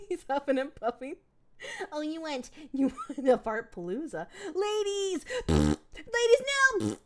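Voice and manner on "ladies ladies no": high-pitched desperate call after them; mock calling out. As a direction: cry out as they walk away. Calling after departing women.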